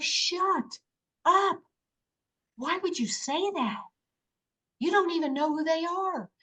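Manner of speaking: high, disbelieving inner voice acted out; scolding herself. Woman argues with herself about the beings, acted-out incredulous scolding. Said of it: "And I said to myself".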